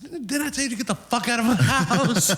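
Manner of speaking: very high-pitched imitation of rage; furious scolding. Baby's dad catches him in the yard, high hammed-up fury. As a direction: squeal it like an outraged man.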